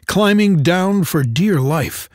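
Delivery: ordinary delivery, nothing marked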